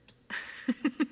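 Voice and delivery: high, loud burst of giggles; giggling. Real, not acted. Streamer amused by viewer's talk of thighs, giggling. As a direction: crack up suddenly.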